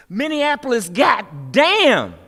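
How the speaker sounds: high, loud cry of outrage; angry shout. Acted. Poet crying out at Minneapolis, furious shout. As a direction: shout the city's name like a curse. Shouted climax of protest poem.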